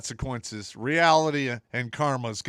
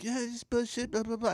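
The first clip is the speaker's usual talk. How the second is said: whiny complaint; 9 semitones higher, 4 dB quieter than usual. Retold or imitated. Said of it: "I'm like".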